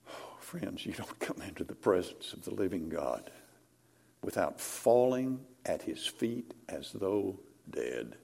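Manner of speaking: awed hush to the congregation; hushed whisper. Acted. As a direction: lean in and whisper the warning. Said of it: "whispered awe after reading".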